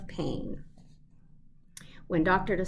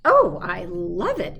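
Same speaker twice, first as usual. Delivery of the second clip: 1 exclaimed; 8 dB louder than usual. A narrator's reading. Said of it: "exclaimed the fox".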